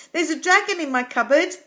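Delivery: high, loud yell across a hedge. A narrator's reading. Jonathan tells the delivery boy next door, yelling. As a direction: call the news out to next door. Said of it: "Jonathan yelled across the hedge".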